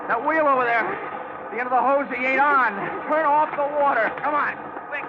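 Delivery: shouting over water. Acted. Detective shouts to partner over the fire hose roar.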